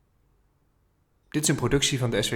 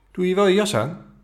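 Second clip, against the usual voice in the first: gentle questioning grandpa voice, higher and a bit louder; grandpa asking; 8 semitones higher, 6 dB louder than usual. A narrator's reading. Grandpa asks the boy about his coat, caring. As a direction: check on the boy kindly. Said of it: "vraagt opa".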